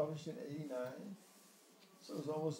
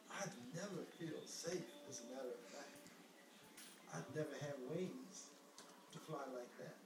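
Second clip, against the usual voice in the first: quiet, shy confession; soft and timid; 9 dB quieter than usual. Acted. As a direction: say it softly, a little scared.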